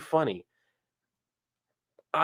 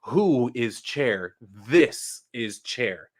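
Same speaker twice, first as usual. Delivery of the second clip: slow, drawn-out "Chair"; slow introduction; much slower than usual. Shown as a demonstration. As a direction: spell out "Chair" slowly for chat. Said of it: "Axolotl is confused".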